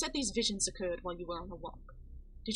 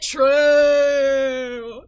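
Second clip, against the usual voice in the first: drawn-out bellowed True!, much louder and higher; hammed-up shouted accent; 6 semitones higher, 19 dB louder than usual. Streamer mocking the patient's accent, with a drawn-out bellowed True!. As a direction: belt out True! in a mock accent, long and loud. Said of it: "The only accent she deserves".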